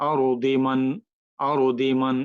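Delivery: আরদি মান linked, dal with i vowel; Quranic recitation, continuing. Shown as a demonstration. Teacher repeating the joined reading আরদি মান. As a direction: say আরদি মান as one flowing unit. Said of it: "এইভাবে যাওয়ার".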